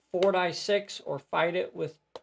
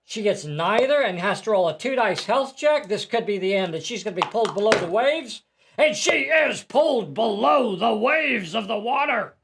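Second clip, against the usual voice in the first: building dramatic excitement; 6 semitones higher, 6 dB louder than usual. Acted. Dramatic sinking narration.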